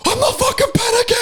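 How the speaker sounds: very high, hammed-up shriek; mock hysterical denial; imitated screaming. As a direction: imitate a man screaming in a panic. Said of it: "he's, like, screaming at me".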